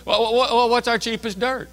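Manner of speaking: high, flustered clerk calling out; calling for help. Retold or imitated. New clerk asks supervisor behind him, calling out. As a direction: repeat yourself as you call over a coworker.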